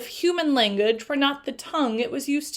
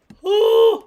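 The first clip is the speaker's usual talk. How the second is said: awed gasp; 11 semitones higher, 10 dB louder than usual. Acted. Awed at the creature.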